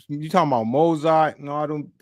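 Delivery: ordinary delivery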